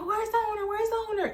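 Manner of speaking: very high, repetitive nagging imitation; mocked yabbing. Storyteller mimics the woman hunting the owner, high mock yabbing. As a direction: mimic her squawked demand way up high. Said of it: "Keeps on yabbing, yabbing".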